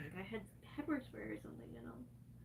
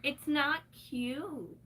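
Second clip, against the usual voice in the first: slow emphatic; 4 dB louder, much slower than usual. Real, not acted. Emphatic complaint.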